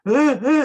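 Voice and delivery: high, loud imitated toddler shrieks; short piercing tantrum screams; mock child screaming. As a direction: shriek like a child having a meltdown. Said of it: "Why are you screaming?".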